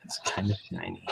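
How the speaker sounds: whispered echo. Pretend. Echoed in a whisper.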